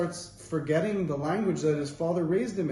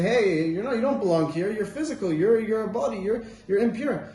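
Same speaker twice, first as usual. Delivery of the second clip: higher, louder rebuke; accusing heavenly forces; 7 semitones higher, 6 dB louder than usual. Retold or imitated. Heavenly forces telling the soul it doesn't belong, higher insistent rebuke. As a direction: push the soul away, insistent.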